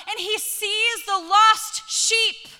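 slow, loud and high on the lost sheep; preaching shout. Real, not acted. Preacher to the women's gathering, slow loud rising proclamation. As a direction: lift it out to the whole room, slowly.

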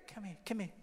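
very soft, frail voice; hushed weak beckoning; Adrian. Acted. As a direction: say it as if too weak to speak up. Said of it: "I can't talk very loud".

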